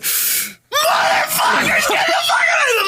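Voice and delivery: re-enacted scream, very high and strained; furious scream. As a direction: scream as if scaring off attackers. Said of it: "I just screamed at them as loud as I could".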